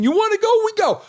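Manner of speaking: put-on high French-accented dare to race; imitating a French driver's challenge. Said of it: "with that French accent".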